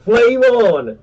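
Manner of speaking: hero catchphrase. Retold or imitated. Retelling the movie scene, a mock heroic cry. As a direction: belt it out like a superhero powering up.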